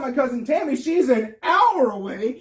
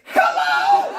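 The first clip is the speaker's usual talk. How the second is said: high shrieking hello with a laugh; desperate scream at a sleeping stranger; top-of-lungs scream; 5 dB louder than usual. Retold or imitated. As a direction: scream it as loud as you can. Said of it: "I screamed at the top of my lungs".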